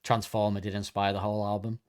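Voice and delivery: normal talking voice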